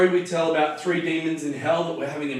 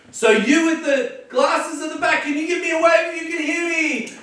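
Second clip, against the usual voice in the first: calling out to the crowd; 10 semitones higher than usual. Shown as a demonstration. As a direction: pull strangers in by name and ask for a wave. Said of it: "even grab a couple of people".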